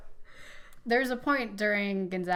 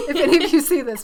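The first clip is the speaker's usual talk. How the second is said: laughing through the warning; nervous laughing plea to listeners; laughing apology; 7 semitones higher, 13 dB louder, faster than usual. Real, not acted. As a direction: giggle while begging them not to be offended. Nervous joke before doppelganger list.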